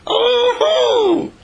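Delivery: very high, drawn-out shriek of alarm; mock horror. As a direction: pretend to be frightened out of your wits.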